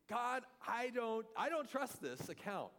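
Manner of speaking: high, slow, loud doubting plea; doubting Thomas. Retold or imitated. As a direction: plead your doubt to God, high and drawn out. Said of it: "known as Doubting Thomas".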